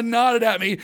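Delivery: ordinary talk, nothing marked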